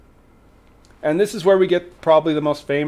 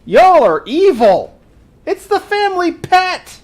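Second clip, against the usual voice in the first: mock accusation; 12 semitones higher, 7 dB louder than usual.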